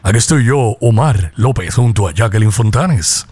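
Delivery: very deep, put-on DJ voice; hammed-up low announcer introducing the next song; mock radio announcer. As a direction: drop way down into parody radio voice. Parodies a radio announcer's time check.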